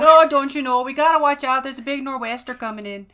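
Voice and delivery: hammed-up Minnesotan accent, loud start then easing. Shown as a demonstration. Warning of a nor'wester, in a Minnesotan accent. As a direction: echo it in a folksy Minnesotan accent. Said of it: "a little more Minnesotan".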